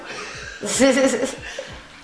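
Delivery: high amused laugh. Real, not acted. Friend's driving joke, she bursts out in high laughter.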